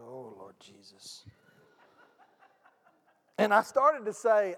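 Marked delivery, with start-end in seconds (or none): 0.0-1.2 hushed prayer. Retold or imitated. Silent prayer when asked his job.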